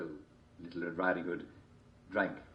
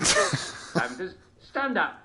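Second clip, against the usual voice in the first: slow Simon says call; 11 semitones higher, 7 dB louder, much slower than usual. Acted. Game host calling commands.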